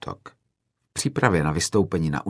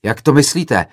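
loud, higher, indignant question; agitated shout; 6 semitones higher, 7 dB louder than usual. A narrator's reading. Another student to the film-maker, agitated shout. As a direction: shout back, upset by the accusation. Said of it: "zařval rozčileně jiný student".

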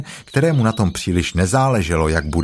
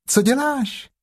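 much higher, small timid Piglet; little Piglet voice; slower than usual. A narrator's reading. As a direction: squeak it like a tiny pig. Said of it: "zvolalo prasátko". (vysoký ustrašený hlásek prasátka)

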